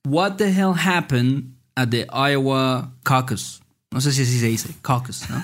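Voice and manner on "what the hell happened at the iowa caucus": slow, careful quiz prompt; teacher reading the quiz sentence slowly. Shown as a demonstration. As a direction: read it out word by word. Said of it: "cómo dices".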